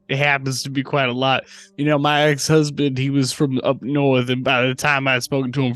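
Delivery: loud, hammed-up Southern accent; infectious Southern drawl. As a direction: play up a thick Southern accent, loud and slow. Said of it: "It's infectious".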